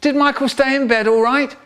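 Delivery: high-pitched motherly enquiry; put-on high, questioning mum; mum calling out. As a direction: call through the door in mum's high voice. Mum calling through brother's door.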